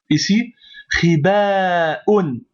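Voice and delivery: khibaoun drawn out very slowly; long vowel demo. Shown as a demonstration. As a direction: khibaoun with the long a held for the class. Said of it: "prolongation ici". (khibaoun, très lentement, le a prolongé)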